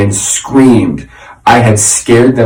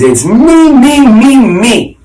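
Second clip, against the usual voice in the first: much higher and louder, bragging me me me; boastful grandfather; 12 semitones higher, 4 dB louder, slower than usual. Acted. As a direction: crow it like a vain old grandfather. Boastful grandfather claims hero.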